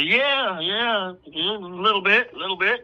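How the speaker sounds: high, sheepish stammered yeahs; nervous Doug. Retold or imitated. Doug caught out, stammering high and sheepish.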